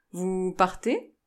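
loud, slow, stiffened question; stronger voice. A narrator's reading. Grandfather stiffens and addresses the visitor, in stronger voice. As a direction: raise the voice and draw the words out. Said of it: "prit une voix plus forte".

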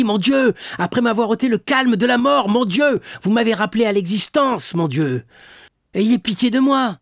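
high, loud, pleading; desperate prayer. A narrator's reading. Imprisoned Dantès begging God, loud desperate prayer. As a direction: beg God for mercy at the top of the voice. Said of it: "s'écria-t-il".

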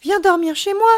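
put-on high, loud childlike invitation; eager child. A narrator's reading. Child invites friend to sleep over, eager.

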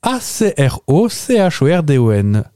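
slow letter by letter A C R O C H O R D O N; spelled out. Shown as a demonstration. Host spelling the word for the guest, slow and deliberate. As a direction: spell each letter clearly and slowly. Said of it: "spelling the word out".

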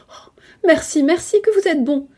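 high, loud outpouring of thanks; grateful exclamation. A narrator's reading. Cousin thanking him for promising the money, exclaiming. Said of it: "Elle s'écria".

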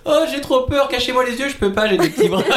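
high, panicky toy dinosaur; scared Rex. Retold or imitated. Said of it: "Genre, euh".

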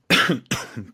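short harsh cough; coughing fit. Real, not acted. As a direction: cough mid-sentence. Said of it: "pardon, je tousse".

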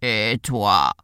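low cow voice; cow character. A narrator's reading. Cow hails the gingerbread man, low cow voice. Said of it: "dit-elle à Pain d'épices".

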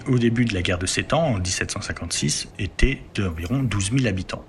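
normal talking voice

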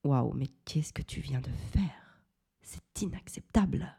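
whispered inner outrage; silent angry look. Retold or imitated. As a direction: hiss it under your breath, shocked. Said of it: "ma détermination de colère à me dire". (regard furieux murmuré)

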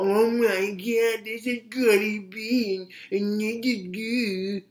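high, slow mock-gushing voice; high-pitched, drawn-out mocking gasp; mocking outraged viewers. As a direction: parody the clutching-pearls crowd. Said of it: "for y'all to sit here and get all".

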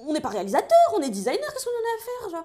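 high-pitched, exasperated retort; retold outburst, played up; mock indignant protest. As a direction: protest it, pitch leaping up on the comeback. Acted retort to a friend.

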